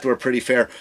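ordinary delivery

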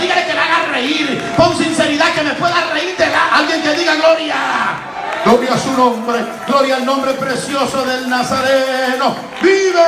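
loud, high shouted preaching with laughs; preaching at full force. Acted. Preacher calling the church to praise, loud fervent shouting.

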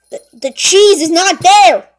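stammer, then shout it out; very loud, dismayed outburst; alarmed shout. Acted. Cook discovering the cheese is gone, shouting in alarm.

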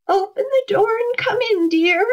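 wolf's high, sweet granny voice; wolf putting on his best grandmother; grandmothery voice. A narrator's reading. Wolf inviting the girl in, in a high grandmothery voice. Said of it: "in his best grandmothery voice".

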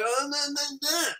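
high whiny no no no; lightly hammed-up dismay; mock despair.

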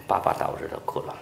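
put-on hushed repeat of the boy's answer; whispered. Shown as a demonstration. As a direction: let it sink in under the breath. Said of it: "但是因为爸爸打我的时候他哭了".

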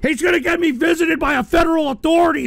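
loud, high-pitched indignant outburst; outraged alarm. Real, not acted. Streamer throwing a troublesome caller out, loudly outraged. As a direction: protest loudly, pitch climbing. Host cuts off extremist caller.

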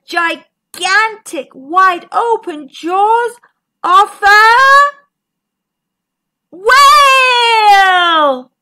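high, loud build to a shout; dramatic reveal. A narrator's reading. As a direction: stretch the last word into a roar. Big reveal of the whale.

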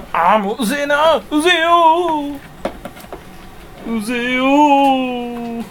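long drawn-out, high-pitched "so annoying" moan; annoyed cry. Real, not acted. Vlogger grumbling at people who irritate him, annoyed drawn-out cry. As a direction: whine it out, stretching each ending. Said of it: "腹立つな".

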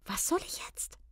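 high, baffled question; utterly confused. A narrator's reading. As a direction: ask it in breathless bewilderment. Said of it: "fragte Maiken völlig verwirrt".

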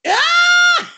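high, loud shriek of glee; real gleeful scream; delighted scream. Real, not acted. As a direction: shriek with laughter. Reaction to edit joke.

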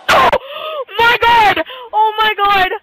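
high, loud cries of disbelief; real ecstatic wailing; overwhelmed shout. Real, not acted. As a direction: cry out, first one long and stretched. Celebrating a goal.

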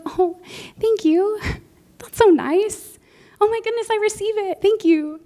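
high, sugary mock gush; lightly hammed-up sweet thank-you voice; polite gushing thanks. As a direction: act out breezy polite gratitude that doesn't sink in. Her polite gushing to prophecies.

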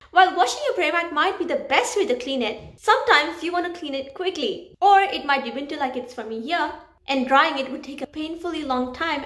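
presenter outro; upbeat outro voiceover. Acted. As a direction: close the video with an energetic summary.

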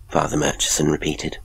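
ordinary delivery

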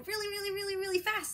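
really pitched high, then rushing through the rest fast; building really fast. Shown as a demonstration. Teacher to young students, eager and fast. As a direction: speed up like a race. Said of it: "going really, really fast".

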